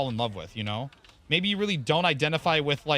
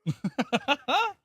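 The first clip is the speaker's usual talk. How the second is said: high laugh at own death; high amused laugh; 11 semitones higher than usual. Real, not acted. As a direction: laugh it off, pitched up. Laugh after getting killed.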